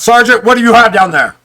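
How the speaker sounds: called out loud and high across the distance; yelled call. Acted. Sailor shouting down to the sergeant, loud call. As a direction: shout it down from far off. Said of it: "one yell out".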